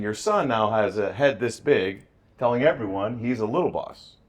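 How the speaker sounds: slow, emphatic, exasperated report; complaining principal. Retold or imitated. Principal calling the ex-wife about the son, slow and exasperated. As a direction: drag it out like a fed-up principal.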